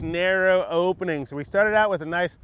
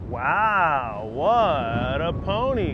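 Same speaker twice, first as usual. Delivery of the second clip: admiring praise; 2 semitones lower, much slower than usual. Real, not acted. Admiring horse after good pass.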